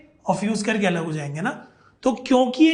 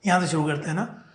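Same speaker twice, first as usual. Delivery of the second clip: ordinary talk, nothing marked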